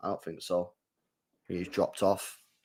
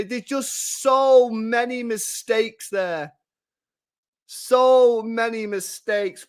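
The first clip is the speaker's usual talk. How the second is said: slow disbelief; slower than usual. Real, not acted. Savouring the blunders slowly.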